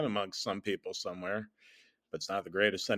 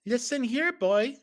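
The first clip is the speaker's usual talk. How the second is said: high-pitched cartoon kid; small, high, bossy voice; Tails; 14 semitones higher, 4 dB louder than usual. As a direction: lecture in a high little character voice. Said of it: "started talking like actual Tails now".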